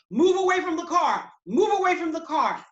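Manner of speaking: loud, high, commanding shout; shouted police order. Retold or imitated. Cop ordering students away from car, shouting. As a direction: shout it like a cop with a gun drawn.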